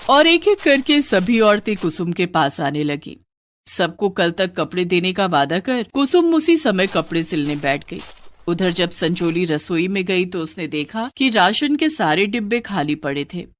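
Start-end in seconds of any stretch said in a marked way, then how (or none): none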